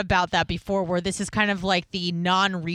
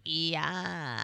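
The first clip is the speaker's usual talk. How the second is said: soft, creaky Kardashian-style fry; vocal fry; 3 semitones lower, 10 dB quieter than usual. Shown as a demonstration. Caller showing what the Kardashians made popular, vocal fry. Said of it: "the vocal fry".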